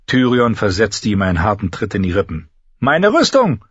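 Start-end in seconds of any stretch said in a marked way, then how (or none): 2.8-3.6 high, sharp order; imperious high-voiced command; demanding. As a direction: demand it from a servant, pitched well up. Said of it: "verlangte er".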